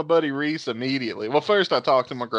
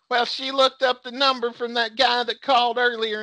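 high-pitched explaining voice; imitated mom; 9 semitones higher than usual. Mom defends her to her son, imitated high voice. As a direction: mimic mom defending the caller. Mom's side of retold argument.

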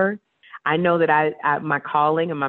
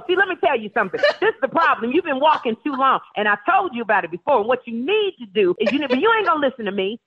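higher, brisk lecturing advice; imitating Niecy; 7 semitones higher, faster than usual. Friend Niecy scolding about walking too long, high bossy voice. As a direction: lay down the law on a sore foot, bossy and quick. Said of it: "And she'll go".

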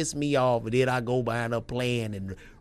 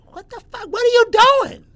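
high, loud outraged yell; imitating wife's yell; 14 dB louder, faster than usual. Wife yelling at him over the photo filter, high loud outrage. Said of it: "And she".

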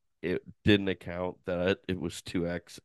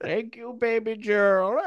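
high, slow mock Indian accent; 13 semitones higher, 4 dB louder, slower than usual. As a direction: drawl it in a hammy Indian accent. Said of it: "my Indian accent".

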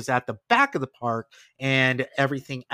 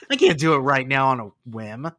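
ordinary delivery

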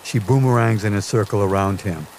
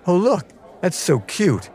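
higher, cooing admirer of a cute dog; gushing passerby; 10 semitones higher than usual. Acted. Passerby admiring a dog outside a restaurant, gushing. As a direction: coo it in a raised voice.

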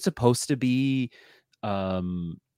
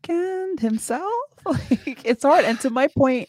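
incredulous squeal; 4 dB louder than usual. Retold or imitated. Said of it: "Yeah, it's like".

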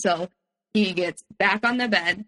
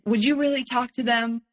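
guilt-tripping plea; 4 semitones higher than usual. Retold or imitated. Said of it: "trying guilt trip me".

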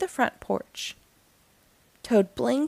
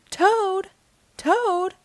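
high, raised calling voice; shouted call; 9 semitones higher than usual. A narrator's reading. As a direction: Frog shouting to rouse Toad. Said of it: "shouted Frog".

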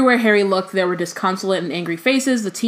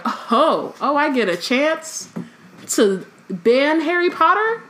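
slow, rising delight at the chance; mock excited Umbridge; 7 semitones higher, slower than usual. Host imagines Umbridge getting a chance to ban Harry, mock excited. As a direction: draw it out, climbing higher. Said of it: "she's so excited".

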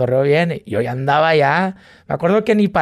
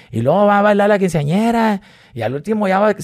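ordinary talk

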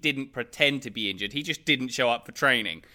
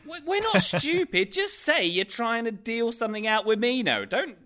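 high, exasperated pleading; 9 semitones higher than usual. Acted. Podcaster scolding players who fake injuries, high and exasperated.

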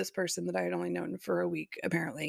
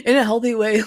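laughing, louder, at the phrase; amused, louder repeat of the phrase; 2 semitones higher, 9 dB louder than usual. Real, not acted. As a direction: laugh it out in disbelief.

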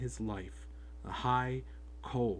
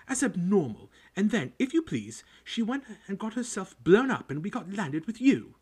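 high, loud, jealous ranting about her sister; spiteful rant; 9 semitones higher, 8 dB louder than usual. Acted. Aunt venting to Harry about her sister, loud spiteful rant. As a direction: spit out years of resentment. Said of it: "went ranting on".

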